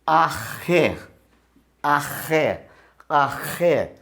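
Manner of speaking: arré with a throaty r like the Spanish j; guttural r. Shown as a demonstration. Teacher showing students the guttural r in arré. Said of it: "Outro exemplo".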